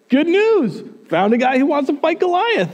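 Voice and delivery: high-pitched, eager messenger; acted delighted report to a king; excited announcement. Messenger bringing King Saul good news.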